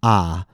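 "ah" as a clear open vowel, unlike "uh"; contrasting vowel AH. Shown as a demonstration. Teacher warns learners not to confuse two vowels, open AH. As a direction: say "ah" open, distinct from a schwa. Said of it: "don't confuse ah for uh".

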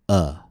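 "uh" as one short, isolated vowel. Shown as a demonstration. Teacher models the vowel for learners to repeat, short isolated "uh". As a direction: say "uh" on its own as a model sound. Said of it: "which contain the vowel".

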